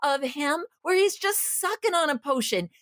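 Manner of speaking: worked up, pitch climbing high; agitated. Real, not acted. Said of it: "I'm getting worked up right now".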